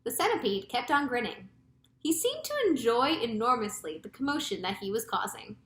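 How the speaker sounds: usual speaking voice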